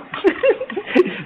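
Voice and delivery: high-pitched giggle; real laugh at your own quip. Real, not acted. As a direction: burst of amused, high laughter. Laughs at own installation remark.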